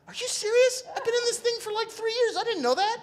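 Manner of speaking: very high, loud amazed outburst; astonished disbelief. Retold or imitated. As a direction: imitate a floored coworker, high and loud. Medic astonished at colleague's faith.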